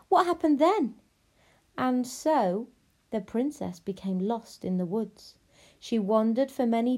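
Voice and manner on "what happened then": high, loud, eager question; eager child. Acted. Abilene begs for more of the story, eager. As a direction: press eagerly for the next part.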